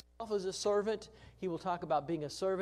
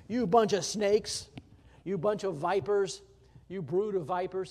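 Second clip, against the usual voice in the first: mock angry denunciation; 3 semitones higher, 7 dB louder than usual. Teacher voicing Jesus rebuking the leaders, loud and scolding. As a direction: call them out like Jesus scolding hypocrites. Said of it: "he'll call them".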